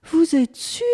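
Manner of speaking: high, loud doubting question; insistent. A narrator's reading. Bunip questions the man's answer, insistent. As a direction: press him for certainty. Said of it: "insista le bunip".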